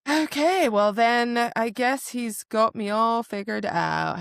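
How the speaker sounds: high, drawn-out "okay", slow sarcasm; exaggerated, slow huffiness; mock huffy. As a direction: act out an affronted comeback. Voices the heroine's huffy reaction.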